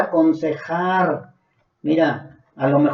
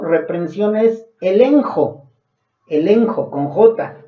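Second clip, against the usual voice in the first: ordinary talk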